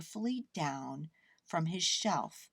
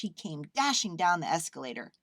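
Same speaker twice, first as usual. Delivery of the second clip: ordinary delivery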